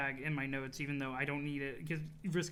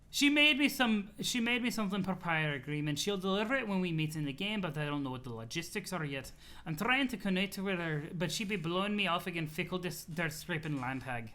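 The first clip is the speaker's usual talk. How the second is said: CA. Acted. CA explaining his deal with her, grumbling accented voice. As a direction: complain in a silly put-on accent. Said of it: "laughing at the C A voice".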